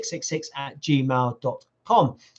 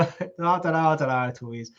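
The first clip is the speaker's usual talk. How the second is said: normal talking voice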